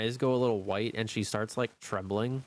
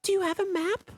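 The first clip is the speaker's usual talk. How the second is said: put-on little girl voice; 5 dB louder than usual. NPC girl asks the party.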